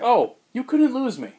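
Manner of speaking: high, loud mock Alfred outburst; Alfred bursting out, hammed up. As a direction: pitch up sharply as the butler. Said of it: "He's like".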